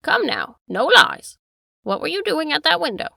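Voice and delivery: stern demand. Acted. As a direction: press him hard for the truth. Master interrogating butler.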